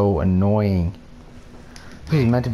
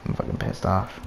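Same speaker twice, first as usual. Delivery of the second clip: soft, hissed complaint; real whispered anger; whispered rage; 5 semitones lower, 8 dB quieter than usual. Real, not acted. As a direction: mutter it through rage. Muttered rage.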